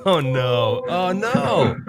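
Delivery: dismay at the audio. Real, not acted. Audio trouble.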